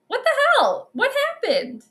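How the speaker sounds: real bewildered outburst; disbelief. Real, not acted. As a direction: pitch it way up in shock. Frozen on live stream.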